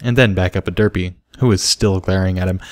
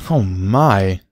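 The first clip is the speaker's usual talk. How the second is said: gasping. A narrator's reading. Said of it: "Bucky gasped".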